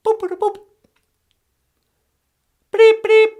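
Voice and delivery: very high beeping noises; computer beeps. Acted. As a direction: beep like an old machine.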